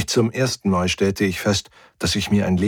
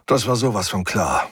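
usual speaking voice

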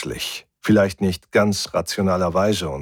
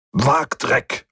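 mildly raised curse; cursing; 5 semitones higher than usual. A narrator's reading. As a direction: swear in irritation. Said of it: "fluchte er hinter der Tür". (verärgert geflucht)

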